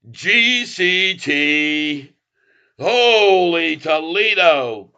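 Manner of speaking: delighted drawl. Real, not acted. Streamer gloating over GCT, slow delighted drawl. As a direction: relish every syllable. Celebrating the stock move.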